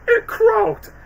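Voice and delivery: high squeaky voice; Gingy. Retold or imitated. Quoting Gingy's croak pun in a high squeak. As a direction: pipe it like a tiny cookie. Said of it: "Gingy was like".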